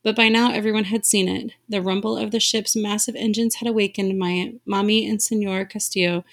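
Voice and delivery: plain reading voice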